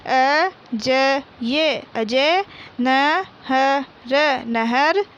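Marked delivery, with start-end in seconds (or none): 0.1-1.8 अजय stretched very slowly, each syllable drawn out; slow syllable reading. Shown as a demonstration. Teacher sounding out अजय for young pupils, very slowly. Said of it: "बोल बोल कर पढ़ेंगे". 2.8-3.8 नहर stretched very slowly, each syllable drawn out; slow syllable reading. Shown as a demonstration. Teacher sounding out नहर for young pupils, very slowly. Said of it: "बोल बोल कर पढ़ेंगे".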